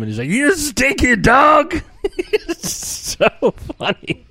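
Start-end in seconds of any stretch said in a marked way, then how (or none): 0.3-1.8 high, drawn-out comic screech; Bobcat Goldthwait scream. Retold or imitated. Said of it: "typical Bobcat Goldthwait style, he screams at him". 2.0-4.2 high, drawn-out words through laughter; real laughing. Real, not acted. Laughing at own impression.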